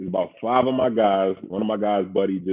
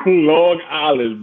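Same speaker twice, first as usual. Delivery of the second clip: "Long Island" stretched slow and louder; drawn-out punchline; 5 semitones higher, 5 dB louder, much slower than usual. Real, not acted. Punchline about his drink order.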